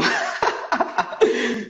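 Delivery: high, breath-filled burst of laughter. Real, not acted. Guest amused by a friend's question, laughing.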